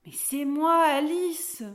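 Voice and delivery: hint of a girl making herself known; lightly voiced little girl. A narrator's reading. Girl reassures startled dwarf who she is.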